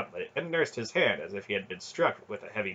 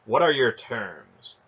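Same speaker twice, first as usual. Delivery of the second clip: slow, louder, cold demand; measured, louder, slightly icy question; 2 semitones higher, 5 dB louder, slower than usual. A narrator's reading. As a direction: demand it slowly with controlled coldness. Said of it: "she said coldly".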